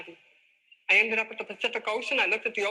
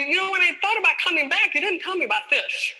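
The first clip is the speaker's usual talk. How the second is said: loud; 7 semitones higher, 6 dB louder than usual. Retold or imitated. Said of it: "And I said".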